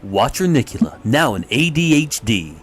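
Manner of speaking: loud, booming promo read; deep announcer voice. Shown as a demonstration. Friend reads slogan on request, big announcer voice. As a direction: boom it into the mic like a trailer. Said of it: "Deep voice, deep voice. I need deep voice".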